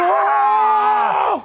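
chillido agudo de susto, más alto de tono; grito agudo. Retold or imitated. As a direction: suelta un alarido de niño sorprendido. Grito del niño atrapado. (grito agudo del niño pillado por su mamá)

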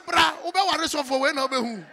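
high, loud exasperated call; loud retort. Retold or imitated. Answering the startled household, loud and exasperated. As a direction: fling it back loudly, half laughing. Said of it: "Me say".

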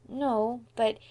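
put-on hesitant child's hedge; lightly cautious little boy, holding back. A narrator's reading. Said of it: "added Davy cautiously".

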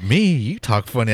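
"Me" pitched high as a question, then "You" stressed; indignant retort. Retold or imitated. Actor answering natives who called his talk funny, indignant retort. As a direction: bounce it back, high on "Me". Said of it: "He's like".

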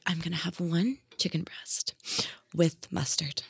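soft, half-whispered magician imitation; hushed, solemn order. Magician ordering food from his waitress, hushed and solemn. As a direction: imitate him ordering in a hush.

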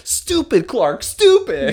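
high mock voice calling Clark stupid; mock self-scolding. Clark scolding himself, high mock whine.

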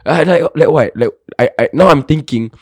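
normal talking voice

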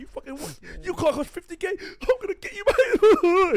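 high-pitched, hammed-up fury building to a loud squeal; mock vengeful rant. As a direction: rage like a petty avenger losing it. Voices vengeful car-keying character.

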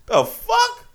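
high disbelieving squeak; high-pitched stunned disbelief; incredulous squeak. Real, not acted. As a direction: squeak it out in shock.

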